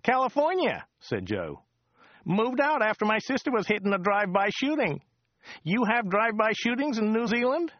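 high, chatty local driver voice; chatty driver Joe. Acted. Driver Joe chatting to Dirk on the drive, high chatty voice. Joe's voice again.

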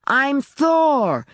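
put-on high-pitched yell of a child at play; narrator's high, hammed-up child's scream. A narrator's reading. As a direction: scream it like an insistent little kid. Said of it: "Zita screamed".

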